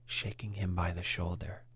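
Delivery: usual speaking voice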